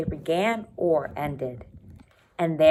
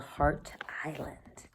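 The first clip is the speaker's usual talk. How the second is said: unvoiced teasing whisper of the title; 9 dB quieter, slower than usual. Real, not acted. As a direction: whisper it like a secret.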